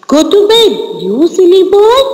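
storybook mother's mild shout. A narrator's reading. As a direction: scold the silly boy, pitch climbing. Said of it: "So mother is shouting".

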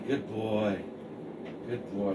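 soft, low praise for a dog; praising a dog. Real, not acted. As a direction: reward the dog gently.

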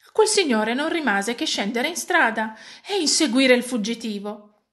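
ordinary delivery, nothing marked

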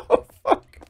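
high burst of laughter; laughing. Real, not acted. Streamer laughing at own mistake, high laugh. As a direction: laugh out a surprised curse.

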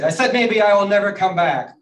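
loud, high-pitched repeat; indignant shout. A narrator's reading. Turtle turning round to the sleepers, indignant shout. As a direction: shout it so they finally hear.

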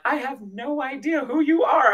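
high, amazed retold exclamation; retold awestruck outburst. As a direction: gush at him in disbelief. Retelling awestruck words to Jesus.

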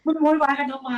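why are you all yelling, asked in exasperation; exasperated. Real, not acted. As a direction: throw up your hands at the film.

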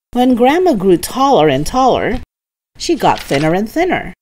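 ordinary delivery, nothing marked

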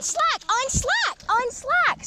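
high, slow, stretched urging; urgent calling out. Real, not acted. Urging the angler with a fish on, urgent call.

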